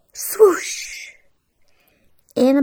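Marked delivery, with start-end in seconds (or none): 0.1-1.1 high, airy magical whoosh; wand swoosh. A narrator's reading. Fairy godmother transforming Cinderella's dress, airy swoosh. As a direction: let the swoosh hiss away.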